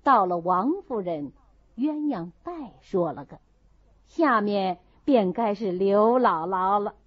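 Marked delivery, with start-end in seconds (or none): none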